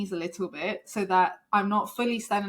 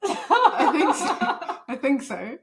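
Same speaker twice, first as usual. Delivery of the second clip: laughing, hesitant reply; unsure answer breaking into laughter; 4 semitones higher, 4 dB louder, slower than usual. Retold or imitated. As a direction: giggle through a doubtful answer. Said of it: "And I was like".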